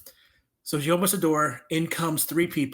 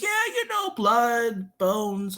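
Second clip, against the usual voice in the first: higher, casual imitation of the woman; blithe listing; 6 semitones higher, much slower than usual. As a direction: toss off horrors like chores.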